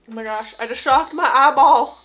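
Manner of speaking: loud startled exclamation. Real, not acted. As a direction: blurt it out, caught off guard. Gets shocked in the eye.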